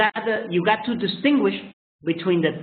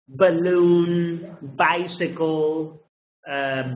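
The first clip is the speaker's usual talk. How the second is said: ordinary talk, nothing marked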